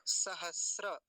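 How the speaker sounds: सहस्र with held s before ra; correct model. Shown as a demonstration. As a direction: say sa-has-ra in सहस्र, no t. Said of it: "केवल इसको देखेंगे".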